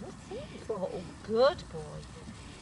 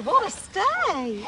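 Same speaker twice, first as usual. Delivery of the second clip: excited praise; 11 semitones higher, 7 dB louder, slower than usual. Real, not acted. Praising dog's sit stay.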